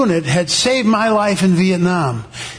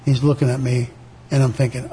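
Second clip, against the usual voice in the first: ordinary delivery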